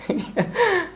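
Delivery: high, soft little laugh; short laugh. Real, not acted. Teacher excuses himself to the online class, short high laugh. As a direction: let out a quiet high chuckle. Laughs after saying sorry.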